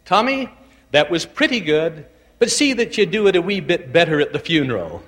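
a hint of an old Scottish widow teasing gently; kindly old Scottish widow, lightly done; Scottish brogue. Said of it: "in her Scottish brogue she would say".

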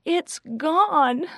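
high, distressed wail; groaning. A narrator's reading. Girl groaning to her friend that it's lost. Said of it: "Rachel groaned".

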